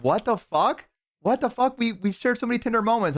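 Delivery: high, upset imitated woman; hammed-up heartbroken partner; betrayed wife. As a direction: protest in a high, wounded voice.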